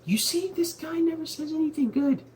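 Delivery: very high squeaky complaining voice; mock whiny voice. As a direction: whine it up high like a tattletale. Jehoshaphat voiced in retold story.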